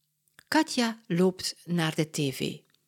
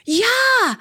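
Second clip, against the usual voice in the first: much higher, louder cheer; excited yes; 8 dB louder than usual. Acted. Katja answers Mama about buying ice cream, thrilled. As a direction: jump at the offer. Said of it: "roept Katja".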